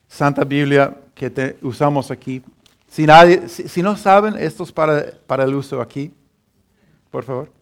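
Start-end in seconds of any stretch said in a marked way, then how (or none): none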